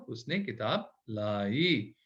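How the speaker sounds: उसने किताब लाई read very slowly; stretched teaching sentence; slow model sentence. Shown as a demonstration. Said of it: "पढ़े इसको".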